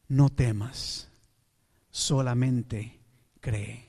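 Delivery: slow, low and soft; calm him with unhurried, quiet words; gentle reassurance. Acted. Jesus telling Jairo not to fear, gently reassuring.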